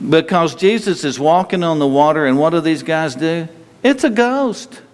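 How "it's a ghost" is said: high, slow spooked cry of "It's a ghost"; mock frightened cry. Disciples in the storm at sea, a hammed-up frightened cry. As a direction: cry it out like a spooked sailor who sees a phantom.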